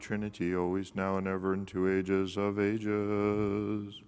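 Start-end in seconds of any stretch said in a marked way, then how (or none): 1.5-4.0 very slow, stretched final phrase; drawn-out exclamation. Real, not acted. Priest closing the litany to the congregation, very slow intoning. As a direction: draw out each word like a solemn intoning.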